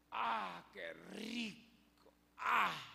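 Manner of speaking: slow, drawn-out delight; mock blissful relief. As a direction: savor the cool shade. Jonás disfruta la sombra. (placer alargado y relajado)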